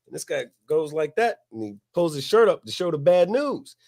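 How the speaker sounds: ordinary talk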